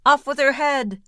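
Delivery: high, loud roar; the prince roaring for an execution; roared order. A narrator's reading. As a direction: bellow the command. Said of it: "the prince roared back".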